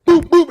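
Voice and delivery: loud high boop boop punches; imitated two-piece hits; punch sound effect. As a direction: mimic two quick punches. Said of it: "He said".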